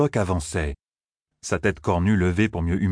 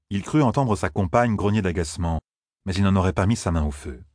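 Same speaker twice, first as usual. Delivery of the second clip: usual speaking voice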